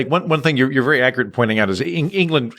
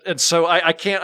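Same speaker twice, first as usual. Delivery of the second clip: ordinary delivery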